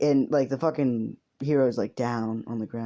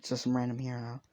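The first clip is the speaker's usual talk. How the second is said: ordinary delivery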